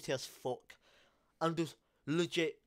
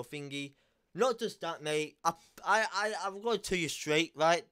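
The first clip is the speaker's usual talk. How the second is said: ordinary talk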